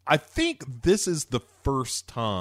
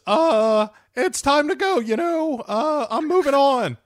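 much higher, airy put-on voice; 12 semitones higher than usual. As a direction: pitch it way up as Martin. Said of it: "And Martin says".

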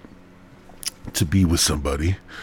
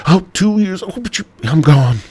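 higher, louder mock dad walking out; deadbeat dad voice; 5 semitones higher, 6 dB louder than usual. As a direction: ham up a careless father leaving. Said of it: "like a real fucking dad".

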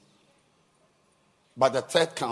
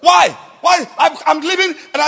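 angry shout; 19 dB louder than usual. Retold or imitated. Wife shouting at husband, retold.

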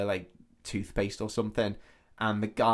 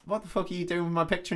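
mock indignant protest; 8 semitones higher, faster than usual. Acted protest at stolen picture.

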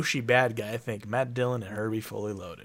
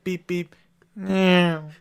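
beep beep meow; 6 semitones higher, 7 dB louder, much slower than usual. Retold or imitated. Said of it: "And I'm like".

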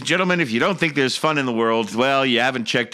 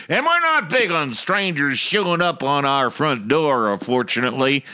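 high, mocking Southern politician voice; hammed-up Southern rally speech; mock Southern accent; 4 semitones higher than usual. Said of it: "She then suggested".

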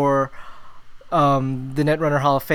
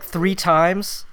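ordinary talk

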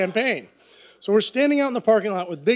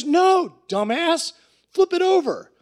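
put-on much higher, impatient drunk order; drunk friend; 9 semitones higher, slower than usual. Drunk Brad tells the narrator to flip the shirt, impatient drunk voice. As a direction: snap at your idiot buddy, plastered. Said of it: "he's all drunk".

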